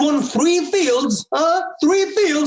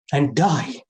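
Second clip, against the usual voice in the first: mild grief; 11 semitones lower than usual. Acted. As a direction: let it sink into sorrow. Lament at end of speech.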